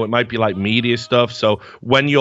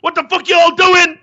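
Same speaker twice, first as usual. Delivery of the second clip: put-on high, loud shout of drunken rage; retold furious outburst; 7 dB louder than usual. Tired and tilted, snapping at mates digging his ribs, high furious shout. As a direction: yell it like you've finally snapped at teasing mates. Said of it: "I just, like, lost it".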